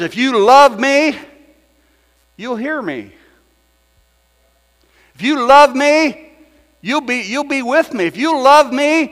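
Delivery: high, loud, insistent divine voice; voicing God pleading. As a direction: plead as God calling his people. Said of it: "he says".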